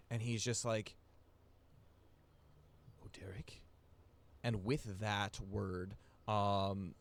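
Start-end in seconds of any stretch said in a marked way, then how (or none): 3.0-3.6 breathless whisper, rising at the end; shocked whisper. Acted. Helmgut whispers his returning son's name in shock. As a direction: barely breathe the name in disbelief. Father stunned seeing son return.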